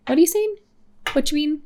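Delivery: high, loud baffled American voice; mock bewildered local who can't follow; confused American listener. Imitating Americans who can't understand her, high and baffled. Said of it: "people being like".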